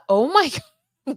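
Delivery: high, loud burst of laughter through the words; laughing. Real, not acted. Host laughing at a viewer's comment, cracking up. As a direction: crack up mid-phrase.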